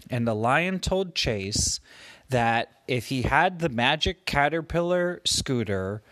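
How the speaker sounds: ordinary delivery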